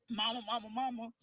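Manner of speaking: normal talking voice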